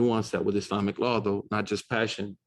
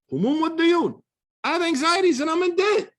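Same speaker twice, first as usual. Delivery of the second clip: high, louder anguished cry; distressed plea; 4 dB louder than usual. Retold or imitated. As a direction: let it break out high with worry. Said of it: "he was distressed".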